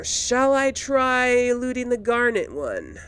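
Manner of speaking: normal talking voice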